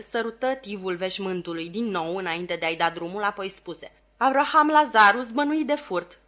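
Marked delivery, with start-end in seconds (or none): 4.2-6.2 high, loud, insistent plea; pleading petitioner. A narrator's reading. Petitioner naming his accused brother to the lawyer, high and insistent. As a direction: press the case in a raised voice.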